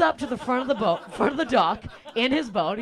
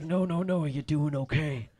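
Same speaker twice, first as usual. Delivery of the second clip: much lower, softer worried voice; low concerned Ross; 8 semitones lower, 6 dB quieter than usual. Retold or imitated. Ross checks on the fallen comic, low and concerned. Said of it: "He's like".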